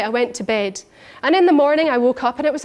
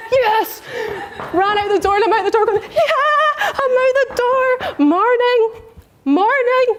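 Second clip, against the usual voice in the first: much higher, laughing and giddy; triumphant glee; 11 semitones higher, slower than usual. Retold or imitated. Woman retelling leaving the room elated, calling good mornings. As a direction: bounce out the door greeting everyone.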